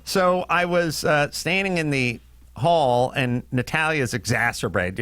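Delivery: usual speaking voice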